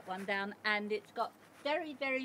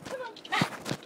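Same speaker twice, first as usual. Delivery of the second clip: calling the dog; 10 semitones higher than usual. Real, not acted. Calling the pet to come out.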